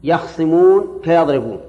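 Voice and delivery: يخصمون slowly, with a silent خ, yakhṣimūn; slow يخصمون rhyming with يضربون; third reading. Shown as a demonstration. Teacher slowly demonstrates the third reading to the students, with a silent خ. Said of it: "القراءة الثالثة".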